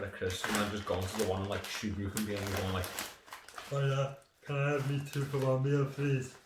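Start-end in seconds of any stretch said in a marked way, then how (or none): 3.7-6.3 sheepish customer voice. Retold or imitated. Mock asking for free meal.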